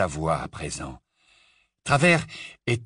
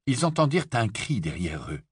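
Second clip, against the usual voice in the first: usual speaking voice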